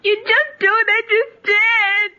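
slow tearful wail; crying. Acted. Girl interrupting as father is told, crying. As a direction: draw the protest out through sobs. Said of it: "what are you crying about".

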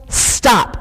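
short, decisive interruption; sudden halt. A narrator's reading. New voice halting the argument, sudden firm command. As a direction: cut everything off with one word.

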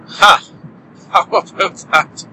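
ordinary talk

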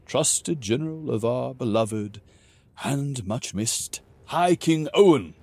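high, loud, grand jovial king; booming warrior king. Acted. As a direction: proclaim his titles with relish. King praises Grog grandly.

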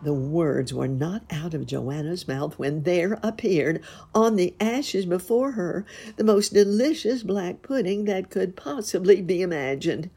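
ordinary delivery, nothing marked

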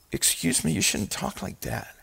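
mock polite. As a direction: say it timidly, as the wrong version. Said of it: "No, that's not what he said".

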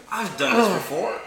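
high, loud put-on voice; mock player reaction. Game master teasing player about being kicked, high mock voice. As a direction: jump up high and loud, hammed-up.

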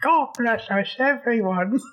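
high little British waif voice; comic high-pitched British child; mock British accent. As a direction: pipe it up in a put-on British accent. Mock British quote continuing accent bit.

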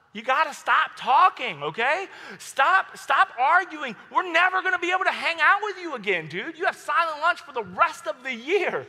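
loud, high-pitched pleading reproach; exasperated scolding. Retold or imitated. Friends telling the arguing student to stop, loud exasperation. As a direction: beg him hotly to quit, fed up.

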